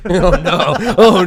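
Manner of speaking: loud, high laughing protest; laughing dismay. Real, not acted. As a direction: crack up while objecting. Cracking up at pun title.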